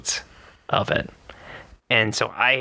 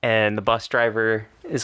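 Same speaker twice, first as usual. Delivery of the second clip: ordinary delivery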